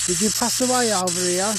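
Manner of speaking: loud, high call into the woods; calling out to spirits. Acted. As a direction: call out to any ghosts nearby.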